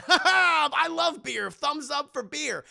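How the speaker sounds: high, loud boozy cheer; drunken beer cheer. Retold or imitated. Drunk boss posing with his beer, cheering loudly. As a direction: laugh it out, then draw out the toast.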